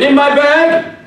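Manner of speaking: high, loud preacher's call. Retold or imitated. Preacher booming at the gang. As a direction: boom it like a pulpit opener. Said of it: "I put on my preacher's voice".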